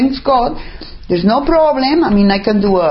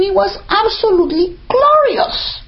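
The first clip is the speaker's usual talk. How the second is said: rapturous; 12 semitones higher than usual. Real, not acted. Rapturous memory.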